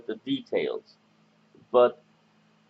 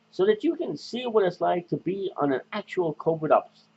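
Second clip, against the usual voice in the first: podcast host; 5 semitones higher than usual. Acted. Presents his podcast persona.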